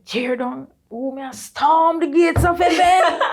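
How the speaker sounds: high, loud, laughing mock intercession; fiery prayer warrior. Cousin Faye's forceful prayer, mimicked high, loud and laughing. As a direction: imitate a cousin storming heaven in prayer. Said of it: "Faye always a pray".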